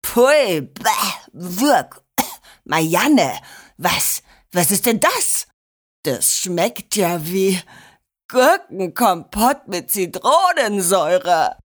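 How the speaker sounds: coughing, revolted grandpa voice; disgusted sputter. A narrator's reading. Grandpa tastes the sabotaged tea, sputtering in disgust. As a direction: spit out the words in disgust.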